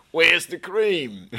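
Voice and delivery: high, loud, slow Australian call; hammed-up Aussie shout for the cream; Australian accent. Speaker joking about Australians, in a loud high Australian accent. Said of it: "that's Australia, mate".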